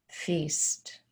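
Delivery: hushed word. Retold or imitated. God's reply in retold story.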